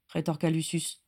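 ordinary delivery, nothing marked

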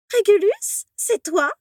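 very high, squeaking surprised question; squeaky ghost voice. Acted. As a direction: squeal his name like a giddy ghost girl. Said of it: "couina Mimi".

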